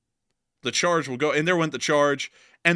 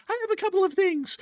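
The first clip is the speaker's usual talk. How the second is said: much higher, light falsetto understatement; mock woman's voice. Host mocks an evacuee woman with too much luggage, falsetto. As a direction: squeak it out innocently.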